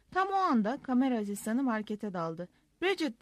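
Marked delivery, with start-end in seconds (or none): 2.8-3.2 higher-pitched, mildly raised call; crying out. A narrator's reading. Camera assistant bursting into the shop for Bridget, crying out. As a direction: call out the name urgently, without a full shout. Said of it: "diye haykırdı".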